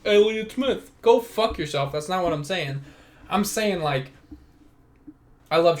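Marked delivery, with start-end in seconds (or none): 0.0-1.9 angry outburst. Acted. Sudden outburst opening the clip.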